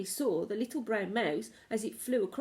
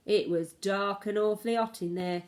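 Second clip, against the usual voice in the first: plain reading voice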